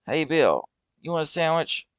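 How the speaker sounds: shout. Acted. Doug calling across the road to Bill, shouting. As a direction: call out to a friend far off. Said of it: "shouts Doug".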